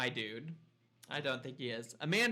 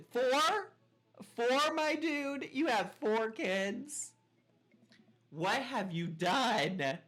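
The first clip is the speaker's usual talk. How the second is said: much higher, louder and slow; shocked disbelief; 9 semitones higher, 4 dB louder, much slower than usual. Real, not acted. Player stunned that a neighbor has four kids. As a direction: gasp it out in disbelief.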